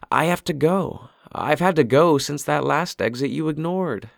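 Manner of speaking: the wife who needs the bathroom now; impatient wife. A narrator's reading. Sheila voiced higher.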